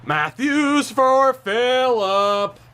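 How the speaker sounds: slow, high, drawn-out goal call; excited announcer-like drawl. Real, not acted. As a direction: stretch the scorer's call out high. Calling out a goal.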